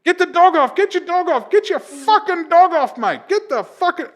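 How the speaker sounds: to the handler, frantic high shout; frantic yelling. Retold or imitated. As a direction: scream at the handler to call it off. Mauled, begging for release.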